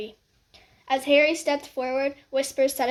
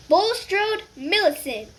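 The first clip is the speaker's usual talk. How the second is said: higher, slow name call; 7 semitones higher, 4 dB louder, slower than usual. Acted. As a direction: call it out to the hall. Names called out.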